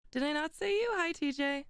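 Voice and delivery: usual speaking voice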